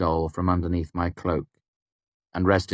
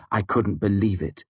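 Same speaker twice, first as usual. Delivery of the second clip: usual speaking voice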